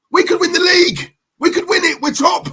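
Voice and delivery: high-pitched, eager team talk; acted rallying excitement. Manager telling the squad the title is possible, excited. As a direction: lift the pitch right up, thrilled at the chance.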